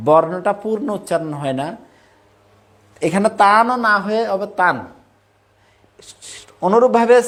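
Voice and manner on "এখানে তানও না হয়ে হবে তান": তান said with no closing vowel, not তানও; তান with halanta, not তানও; silent final vowel. Shown as a demonstration. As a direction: clip তান to end on the n with no vowel. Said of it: "বর্ণের শেষে হসন্ত থাকে".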